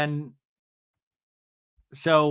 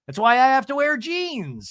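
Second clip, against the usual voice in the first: high, louder impression; mock Emily; 10 semitones higher, 6 dB louder than usual. Host mimicking Emily's jab, high-pitched. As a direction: deliver the dig high and pointed. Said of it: "And Emily's like".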